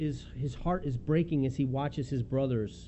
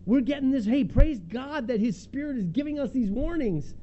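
louder and higher, pressing plea; urgent plea; 9 semitones higher, 5 dB louder than usual. Retold or imitated. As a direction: cry out for him to stay. Disciples begging Paul not to go.

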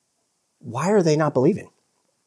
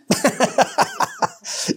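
high, real burst of laughter; helpless high chuckling; amused laugh; 5 dB quieter than usual. Real, not acted. Preacher amused by his own retelling.

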